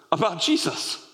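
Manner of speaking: ordinary talk, nothing marked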